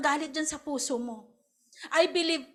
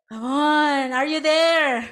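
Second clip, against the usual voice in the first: louder, calling the crowd; rousing call; 7 dB louder, much slower than usual. Real, not acted. Preacher rousing the congregation, loud call. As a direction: wake them up with it.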